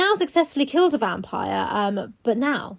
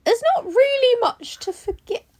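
high, chirpy forgiving voice; sing-song breezy reassurance; high put-on voice; 11 semitones higher, 4 dB louder than usual. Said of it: "it's like".

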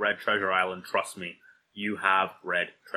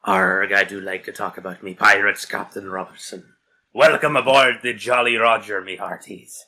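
put-on pirate accent; 10 dB louder than usual. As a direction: growl it out like a cartoon pirate captain. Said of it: "the most stereotypical pirate accent".

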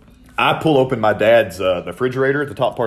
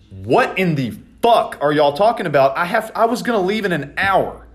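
annoyed outburst; 8 semitones higher than usual. Retold or imitated. Angry reaction to sudden plan.

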